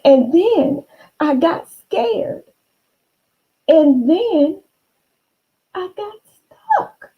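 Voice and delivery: high, slow and close to tears; a frightened little monster, voice near crying; small scared voice. Acted. Monster telling Marilyn it got stuck, high, slow and teary. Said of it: "in a small, soft voice".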